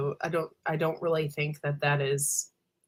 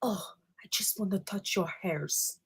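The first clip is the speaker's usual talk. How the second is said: whispered quote; 9 dB quieter than usual. Retold or imitated. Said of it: "she's like".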